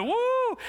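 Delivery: sharp high falsetto woo; a yelp of warning; mock alarm whoop. Real, not acted. Exclamation warning.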